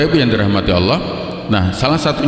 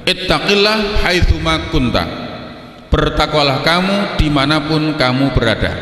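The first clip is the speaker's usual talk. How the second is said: khutbah recitation; 6 semitones higher than usual. Shown as a demonstration. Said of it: "tiga hal yang beliau sampaikan".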